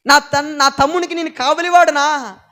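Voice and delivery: loud, scornful retort; Cain's defiant retort. Acted. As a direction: throw the question back defiantly.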